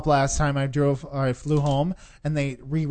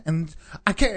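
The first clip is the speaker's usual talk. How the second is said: ordinary delivery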